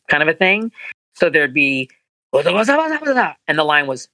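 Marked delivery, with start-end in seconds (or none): 2.3-3.3 high rising-falling blah blah; sing-song gibberish. Shown as a demonstration. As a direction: rattle off mock Japanese. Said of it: "Japanese line demo".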